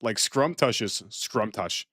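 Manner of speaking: normal talking voice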